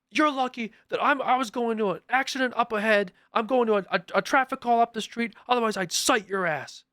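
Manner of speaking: high, strained telling-off in imitation; screaming cop. Cop threatening a speeder with a citation, screaming. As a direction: rant at the driver as an aggressive officer. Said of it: "he starts screaming at me".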